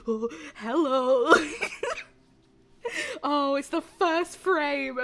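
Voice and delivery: loud, high, slow squeals of delight; giddy shrieking with laughter; squealing fangirl. Real, not acted. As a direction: melt over the first glimpse. Shrieking at the character reveal.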